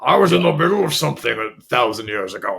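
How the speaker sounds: a hint of a fed-up brute; defiant monster. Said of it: "one of the monsters, not be into Skeletor".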